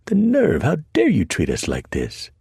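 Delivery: a high, loud cry of outrage; outraged shriek. Acted. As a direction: shriek it in offended disbelief. Sister outraged at being chained.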